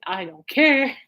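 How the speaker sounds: drawn-out last word, mock apathy. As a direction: repeat it with showy indifference. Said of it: "just hearing [name] going".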